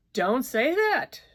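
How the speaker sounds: high, loud, slow outburst; shout. A narrator's reading. Distraught woman shouting at her lover. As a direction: shout him down. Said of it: "she shouted".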